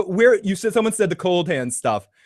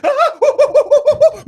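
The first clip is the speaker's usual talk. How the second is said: high burst of laughter; laughing wildly; 6 dB louder than usual. Real, not acted. Showing viewers his freak-out, laughing. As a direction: crack up loudly. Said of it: "visual depiction of what I was doing".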